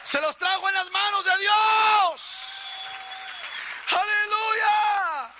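loud, high-pitched preacher building to a shout; impassioned preaching. Real, not acted. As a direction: preach it at full force, climbing to a long, shouted final cry. Preaching at full force. (prédica exaltada que sube hasta el grito)

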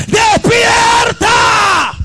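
high, very slow wake-up cry; drawn-out shout. Real, not acted. Preacher waking a sleeping church, long shout. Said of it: "hoy yo te grito".

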